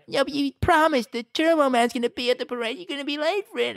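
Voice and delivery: put-on high-pitched little boy pleading; kid Jamie voice. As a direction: whine like a kid who wants his dad home.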